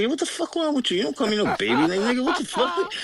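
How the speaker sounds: high-pitched, indignant squawk; mock outrage. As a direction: act scandalized at being called baby. Retelling his outraged phone reply.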